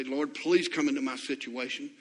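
desperate kneeling plea; begging prayer. Pretend. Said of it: "get on your knees and say".

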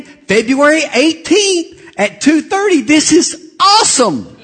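loud, high, slow proclamation; hammed-up high-pitched thrill, each word drawn out; excited receptionist. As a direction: announce it with over-the-top delight. Retold receptionist's excited announcement.

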